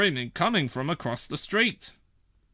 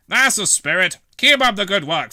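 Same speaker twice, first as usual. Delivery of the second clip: a louder, higher voice for the station officer; hearty officer; 6 semitones higher, 8 dB louder than usual. Acted. Station Officer Steele encouraging Fireman Sam, hearty and loud. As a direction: cheer him on like a proud boss.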